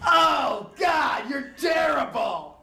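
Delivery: high, loud, drawn-out yelled insult; hammed-up appalled yell; mock disgusted shout. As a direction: slam the door on her with a shout. Hack rejecting escort at door.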